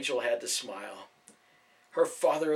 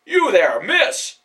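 loud, high call across the street; 9 semitones higher, 12 dB louder, much slower than usual. A narrator's reading. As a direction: hail her urgently. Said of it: "he called to Rachel".

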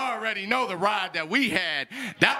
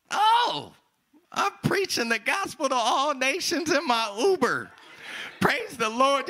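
overjoyed cry; 7 semitones higher than usual. Retold or imitated. Realizing he's preaching to all nations in his Uber, high joyful cry. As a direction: burst out with joy.

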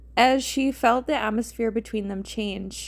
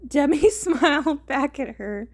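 higher, giggly narration; laughing while reading; 4 semitones higher, 4 dB louder than usual. Real, not acted. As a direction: read it through a laugh. Laughing as she rereads the line.

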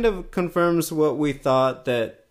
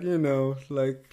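usual speaking voice

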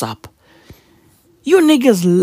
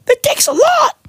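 much higher, wailing mock sob; mock crying. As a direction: whimper "It takes a lot" in a hammed-up cry. Comic mock sob repeat.

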